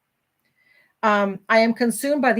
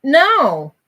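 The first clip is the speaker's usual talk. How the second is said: loud, higher-pitched no; a sharp, raised no that shuts the excuse down; emphatic refusal; 5 semitones higher, 7 dB louder than usual. Real, not acted. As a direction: reject it outright, loudly. Rejects the excuse she hates.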